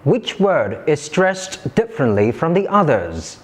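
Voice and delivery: ordinary talk, nothing marked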